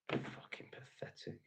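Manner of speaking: slow, whispered disgust under the breath; muttered aside. Real, not acted. Streamer reacts to a commenter, whispered disgust. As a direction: breathe it out in contempt.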